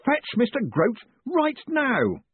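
high, stretched-out urgent yell; scream. A narrator's reading. Boss screaming for Mister Grote, frantic. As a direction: yell the order, drawing out each word. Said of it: "Moist von Lipwig's scream".

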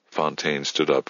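plain reading voice

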